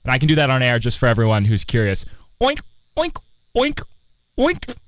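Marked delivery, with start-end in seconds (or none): 2.4-4.8 high squeaky oink oink, like a pig. Shown as a demonstration. Showing off a pig impression to the audience, high oinks. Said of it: "I can do a pretty good pig impression".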